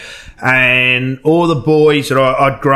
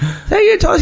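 put-on old auntie voice. Retelling Auntie Joyce answering the prank call, high shrill-free lady voice. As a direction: imitate the auntie squawking down the phone.

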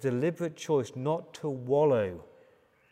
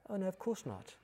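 put-on soft, higher child's reply; hushed little voice, pitched up; little daughter; 6 semitones higher, 10 dB quieter than usual. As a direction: answer quietly as a small girl to her daddy. Little daughter answers father's question.

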